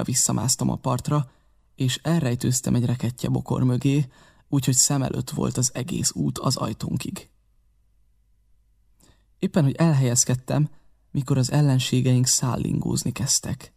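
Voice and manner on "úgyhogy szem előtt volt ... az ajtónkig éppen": usual speaking voice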